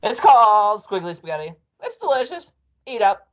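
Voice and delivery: high, loud coaxing; coaxing Mrs Twit. A narrator's reading. Wife urging husband to eat worms, high coaxing. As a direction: sell it to him in a high-pitched voice.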